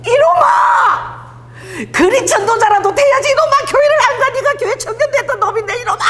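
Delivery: very high, shrieking mock-mom tirade; scolding mother. Mother scolds churchless son on a phone call, high shouted rant. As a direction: yell like an exasperated mother on the phone. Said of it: "엄마가 전화하면은".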